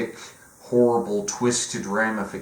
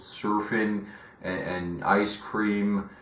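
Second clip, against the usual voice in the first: ordinary delivery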